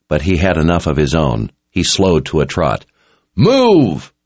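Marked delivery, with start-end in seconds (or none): 3.3-4.1 high, drawn-out call; urgent called command. A narrator's reading. Richard bears down on the people, calling out urgently. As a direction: call out to scatter a crowd. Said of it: "Richard called as he bore down".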